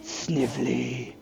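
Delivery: low, very soft, slow villain voice; Jim Cummings's Doctor Robotnik. Acted. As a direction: croon it low and slowly, villainously. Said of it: "voice impression of Jim Cummings, Doctor Robotnik".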